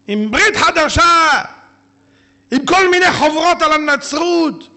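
high, loud, fiery sermon; fiery preaching. Real, not acted. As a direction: thunder against the missionaries. Preaches at full force. (דרשה סוערת ברמה וגבוהה)

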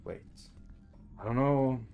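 ordinary delivery

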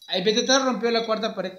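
ordinary talk

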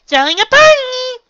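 very high, loud squeal; excited cry. A narrator's reading. Pinkie interrupts the princess, excited squeal. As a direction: shriek it out gleefully and stretched. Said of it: "Pinkie cried".

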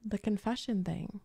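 ordinary delivery

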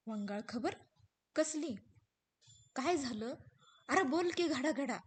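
rising urgent questions; alarmed wife demanding. Acted. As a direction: fear pushing her on. हिरा घाबरते. (घाबरलेली हिरा, लवकर सांग म्हणणारी)

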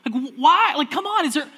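frustrated plea. Retold or imitated. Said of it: "I remember being so frustrated".

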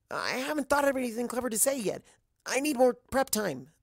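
high, fast, flustered protest; blurting out a panicky excuse; nervous protest. Acted. Twilight protesting to Applejack, high and flustered.

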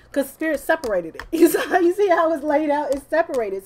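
loud, high excited pitch; the cards separated, told loud and high; excited explaining. Real, not acted. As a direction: show them the spread, thrilled.

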